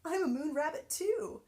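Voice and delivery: high, loud gleeful boast; proud call. Acted. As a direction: shout it as you bound along. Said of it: "he called to Annie".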